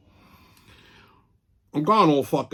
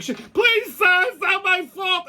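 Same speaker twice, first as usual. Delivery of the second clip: high, slow, desperate whimper-shout; squeaky pleading kid imitation; pleading scared kid; much slower than usual. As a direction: beg like a terrified clerk. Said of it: "The kid was like".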